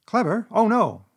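high, loud, puffed-up false humility; mock modesty. A narrator's reading. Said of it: "Toad was puffing and swelling already".

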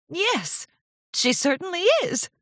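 understated miffed politeness; grudging suspicion. A narrator's reading. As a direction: agree while secretly resenting it. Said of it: "narrowing her eyes".

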